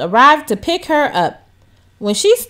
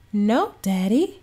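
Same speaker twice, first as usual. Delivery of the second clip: slow, softer little reply; said quietly; 6 dB quieter, slower than usual. A narrator's reading. Daughter answering her father's question, quiet and slow. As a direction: answer shyly under her breath. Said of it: "said Bella quietly".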